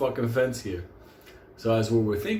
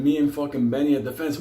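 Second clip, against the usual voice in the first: usual speaking voice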